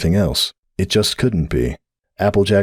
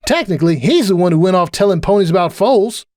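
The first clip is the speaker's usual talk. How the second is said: high defensive character voice; Applejack, defensive; 12 semitones higher, 3 dB louder than usual. A narrator's reading. Applejack blaming Sunburst, high and defensive. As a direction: pitch it up and shift the blame.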